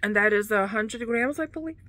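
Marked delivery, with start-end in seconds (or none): 1.4-1.8 words stretched by a yawn. Real, not acted. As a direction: say it mid-yawn. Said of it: "I yawn so much when I talk on camera".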